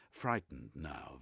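plain reading voice

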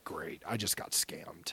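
hushed, low, deadpan whisper under his breath; whispered aside. Retold or imitated. Retelling his own doubt to the host, whispered. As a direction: mutter it like a private thought. Private aside about being scammed.

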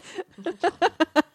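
high-pitched burst of giggling; high giggle. Real, not acted. Laughing at own joke.

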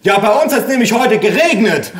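put-on high, loud oblivious old man; hard-of-hearing grandpa. A narrator's reading. Deaf grandpa answering off topic, loud and high.

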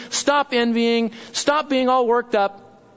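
ordinary delivery

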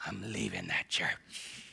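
muttered aside. Retold or imitated. Pastor parodying a sulking member, muttering. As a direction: grumble it under your breath.

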